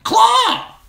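very high, loud squawked word; a raven crying out the word. Acted. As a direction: shriek it like a raven. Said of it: "the raven cried".

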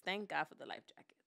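fast whispered thanks; whispered relief. Real, not acted. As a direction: breathe it out in a quick whisper. Relieved aside.

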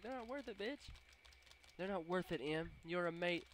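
very high mock consoling; high-pitched playful sass; sassy mock reply. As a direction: coo it in a high, silly voice.

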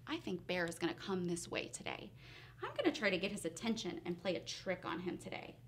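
low, soft, quick thinking aloud; fox's quiet private plotting. A narrator's reading. Fox deciding to trick Bear, low and quiet. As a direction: murmur the plan quickly to yourself. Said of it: "and he decides".

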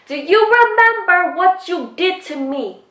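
high, slow, drawn-out accusation; indignant confrontation. Retold or imitated. Confronting the man over what he did, indignant high voice.

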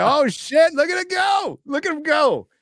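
high-pitched shouted cheer; whooping, very high-pitched shout; excited onlooker. Acted. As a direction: yell it like you're cheering someone on.

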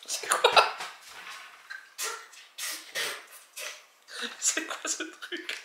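head down on arms; amused reaction after bite; laughing while speaking. Real, not acted. Seen 0: hand on chest, head on arms, laughing; no chewing visible.

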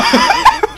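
very high squealing laugh; shrieking laugh. Real, not acted. Watching his friend jump, squealing with laughter.

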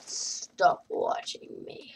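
slow, drawn-out whispery plea; hushed warning. Real, not acted. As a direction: stretch it out under your breath. Telling others to stop watching.

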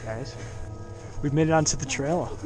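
ordinary delivery

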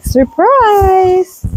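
high, slow teasing "surprise"; spring it on them like a prank; drawn-out singsong gotcha; mock surprise. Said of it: "And you're like".

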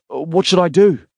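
scared and asking for help fast; panicked question. Acted. Dee panicking while driving.

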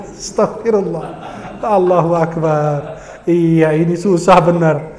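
very slow, weary exasperation with a chuckle; comic despair. Retold or imitated. Preacher giving up on a repeat debtor, comic despair. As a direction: draw each word out in mock despair.

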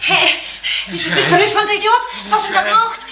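panicked laughing scold; half-laughing in shock; hysterical. Acted.

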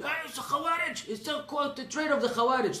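high, breathless mock alarm; mock excited gasp. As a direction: parrot an overexcited critic. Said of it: "some people get so excited".

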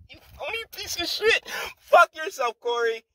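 mock high squeal. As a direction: spit it out in a jeering falsetto. Mocking outburst at Cory.

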